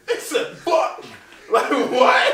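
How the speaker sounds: high, loud, laughing outrage; laughing disbelief. Real, not acted. Comedian laughing at the host who cursed at his mom. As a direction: laugh while asking in shock.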